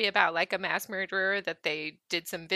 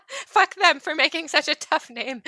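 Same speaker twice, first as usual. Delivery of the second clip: laughing; 6 semitones higher, 5 dB louder than usual. Real, not acted. Mock frustrated at name.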